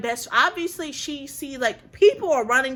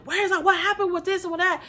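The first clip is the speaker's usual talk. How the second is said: higher-pitched rush of questions, retold; mock-frantic questioning, pitched up; agitated friend imitation; 7 semitones higher than usual. Friend demanding to know what happened after the fight, agitated.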